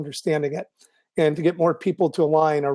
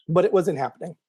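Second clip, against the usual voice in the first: hoarse voice. Real, not acted. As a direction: voice going hoarse. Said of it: "I'm losing my voice".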